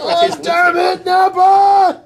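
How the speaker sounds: high, drawn-out exasperated yell; exasperated shout. Acted. Quoting a cartoon outburst to co-hosts, exasperated shout. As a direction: shout it high, hitting the name hardest.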